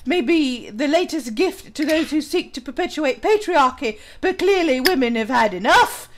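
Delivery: put-on English accent, reading aloud. Streamer reading an article on patriarchy aloud, English accent. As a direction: read it out in a mock English accent. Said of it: "when I'm in my English accent".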